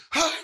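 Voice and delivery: short high startled yelp; grito imitado. Retold or imitated. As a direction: imitate a sudden startled scream. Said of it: "deu um grito assim". (grito de susto imitado)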